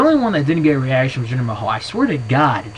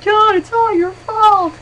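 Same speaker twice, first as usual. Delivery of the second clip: very high, loud, slow whiny wail; mock crying Eve; 7 dB louder, slower than usual. Mocking Eve's overacted crying on Raw, wailing high. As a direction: ham up a sobbing soap-opera line. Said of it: "Eve's crying and crap".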